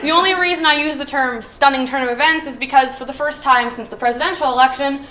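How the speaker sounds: normal talking voice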